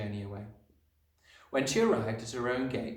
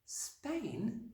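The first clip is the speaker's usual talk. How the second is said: put-on small, high, soft child's voice; little girl's hushed, high question; dismayed disbelief; 12 semitones higher, 7 dB quieter than usual. A narrator's reading. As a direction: echo it softly in high-pitched shock. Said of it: "Matilda cried out".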